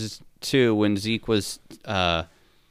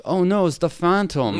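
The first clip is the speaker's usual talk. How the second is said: higher-pitched French-accented imitation; mock French-Canadian accent; 8 semitones higher than usual. Imitating the young actor playing Zeke, in a mock French-Canadian accent. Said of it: "same accent as Jean-Claude Van".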